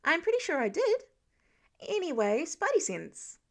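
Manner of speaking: put-on chatty young Spider-Man explaining; lightly voiced eager teenage boy; eager teen hero.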